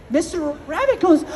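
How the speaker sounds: ordinary delivery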